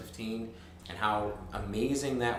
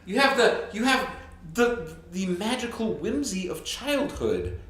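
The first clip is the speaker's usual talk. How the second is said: mock whimsical; 7 semitones higher than usual. Whimsical hammed-up praise.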